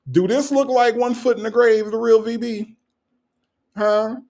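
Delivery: high-pitched incredulous challenge; mock indignation. Host challenges the commenter over a picture, mock indignant. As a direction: press them for an answer, playfully outraged.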